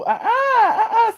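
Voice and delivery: high laughing uh-uh. Real, not acted. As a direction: laugh it off, refusing. Laughing refusal.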